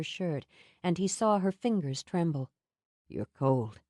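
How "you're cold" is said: low gentle Sam. A narrator's reading. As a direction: lower voice, tender. Sam noticing her tremble.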